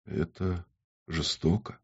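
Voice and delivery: very soft, halting words; barely manage to get it out, hushed; whispered. A narrator's reading. Stunned woman protesting the cruelty, whispered. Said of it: "сумела прошептать".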